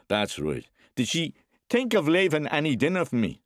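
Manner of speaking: rising to a loud, high demand; domineering man demanding his due; bullying father. Acted. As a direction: start level, then flare up. Bullying father questions boy.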